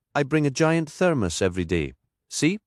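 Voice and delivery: a lightly voiced Geordie lilt, proud of her thermos; vicar's voice. Anna shows off her thermos.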